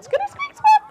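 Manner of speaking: very high squeaky chatter; tiny high mother-mouse squeaks; Mama Mouse squeaking. Acted. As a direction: give orders in mouse language. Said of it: "She said".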